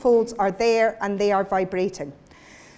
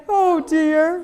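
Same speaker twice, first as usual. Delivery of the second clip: higher, louder "Oh dear", thin and sobby; thin fold voice; 6 semitones higher, 5 dB louder than usual. Shown as a demonstration. Teacher showing the group the thin-fold sound. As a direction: lift it into a high, sobbing "Oh dear". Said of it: "And again".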